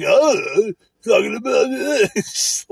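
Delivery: high, slow whiny mockery; teasing a clueless coworker in a whiny falsetto; mocking voice. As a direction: stretch it out high to mock him. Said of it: "I was just mocking this dude".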